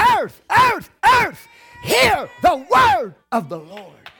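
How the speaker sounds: EARTH shouted high and loud each time, then slowing; shouted emphasis. Shown as a demonstration. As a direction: hammer every "earth", draw out "hear the word".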